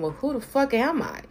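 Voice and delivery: flinging the question out in disbelief; exasperated outburst. Retold or imitated. Retelling her own frustration, high exasperated cry. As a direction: pitch jumps high on who the fuck. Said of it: "I'm sitting there going".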